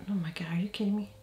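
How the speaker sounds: whispered disbelief at her own mistake; exasperated whisper. Real, not acted. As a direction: breathe it out, annoyed at the flub. Blooper, reacting to her own flub.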